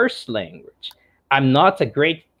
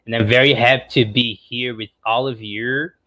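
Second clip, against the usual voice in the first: usual speaking voice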